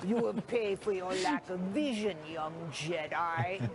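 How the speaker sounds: heavy breathing, then slow, low menace at the end; Darth Vader. Acted. Anchor quoting Star Wars to a colleague, villain impression. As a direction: breathe hard, then draw out the last words low. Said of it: "Darth Vader, right?".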